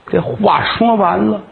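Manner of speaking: usual speaking voice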